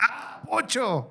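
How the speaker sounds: high, drawn-out mock exclamation; acted startled cry; sudden outburst. Acted sudden outburst after quiet.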